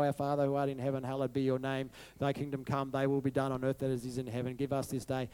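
quick, quiet mumbled prayer said by habit; rote recitation. Retold or imitated. Congregation reciting the Lord's Prayer by rote, fast and quiet. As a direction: rattle it off without meaning it. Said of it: "It just became rote".

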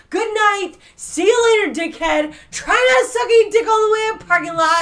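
loud, high mock-angry farewell; shouting. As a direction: yell the goodbye insults.

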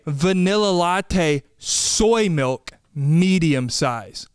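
drawn-out barista read-back, word by word; slow condescending repeat. Retold or imitated. Barista slowly repeating his order back, patronizing. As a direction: spell the order back to him slowly, like he's a child. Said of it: "She goes".

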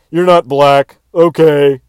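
a cop giving up with a shrug; mock cop. Comic retelling cops backing down, loud mock cop.

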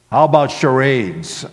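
loud, mildly mocking suggestion; pointed, sarcastic question; rather sarcastic. A narrator's reading. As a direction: offer it with a sarcastic edge. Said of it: "I said rather sarcastically".

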